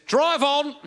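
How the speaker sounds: high, loud command to keep driving; urgent shout. Retold or imitated. Lynn urging him to flee the scene, shouting. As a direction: bark it like a getaway driver. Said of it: "she says".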